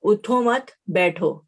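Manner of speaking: slow "اٹھو مت", break, then "بیٹھو"; pause after مت. Shown as a demonstration. Teacher shows students the order to sit, pausing after "مت". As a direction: keep "اٹھو مت" together and stop before "بیٹھو". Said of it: "اٹھنے کی نفی اور بیٹھنے کا حکم".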